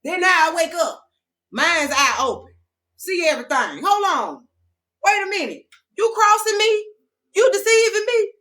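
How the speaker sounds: high, loud, rising indignation; dramatic realization. Pretend. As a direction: build up outrage at being crossed. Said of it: "acting out waking up".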